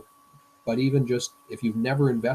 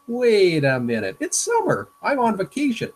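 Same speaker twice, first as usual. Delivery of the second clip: put-on much higher and louder, a kid's sudden protest; Calvin realizing; 10 semitones higher, 8 dB louder than usual. Calvin in the cartoon catches himself, protesting loud and high. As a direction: pull up short like a kid remembering it's summer. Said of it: "Calvin has a thought".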